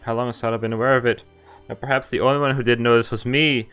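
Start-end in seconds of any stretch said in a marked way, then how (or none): none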